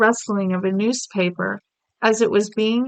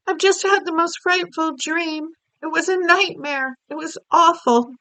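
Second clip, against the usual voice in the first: the Queen shaken; 8 semitones higher than usual. Acted. Queen recounts her nightmare.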